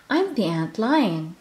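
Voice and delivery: put-on a child's proud boast; Little Mai boasting of her part, proud; proud declaration. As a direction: announce the role with pride. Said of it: "declared Little Mai proudly".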